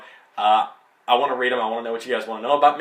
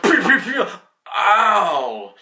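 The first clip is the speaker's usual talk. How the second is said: high, loud mock gunfire pew sounds, then a sharp ow; gunshots and a yelp; 6 semitones higher, 5 dB louder, much slower than usual. Vlogger retelling Logan taking hits, mock gunfire and yelp. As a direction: playfully imitate shots hitting Logan and his pained cry.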